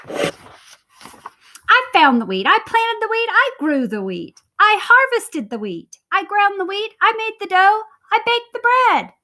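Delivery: little red hen furious at being ignored, high and loud; indignant hen. Acted. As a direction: pile up the grievances.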